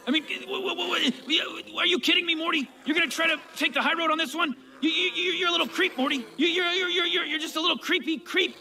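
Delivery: high-pitched, sputtering outrage; indignant stammering rant. Acted. Grandfather sputtering at his grandson over the blame. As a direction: sputter out the accusation in disbelief. Rick rants back at Morty.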